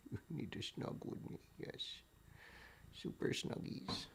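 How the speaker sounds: hushed whisper to a cat; whispered pet talk. Real, not acted. Owner whispers sweet talk to her cat as they snuggle.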